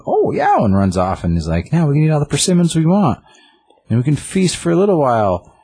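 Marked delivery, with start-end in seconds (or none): 0.1-0.6 way higher, cartoonish taunt; squeaky mock taunt. Chibi Jay taunts Big Usagi, squeaky and high. 1.7-5.5 gleeful little demon. Retold or imitated. Chibi Jay gloats as he runs off with the persimmons, high glee.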